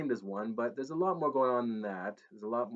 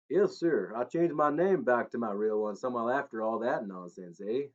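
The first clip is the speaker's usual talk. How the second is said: put-on Southern accent sliding off; muddled Tennessee twang; 4 dB louder than usual. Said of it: "muddling up his Tennessee twang".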